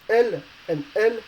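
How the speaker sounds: both L's pronounced exactly alike; identical pronunciation. Shown as a demonstration. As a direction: say L and L with no difference between them. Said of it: "are the same. Basically, you don't have a different pronunciation".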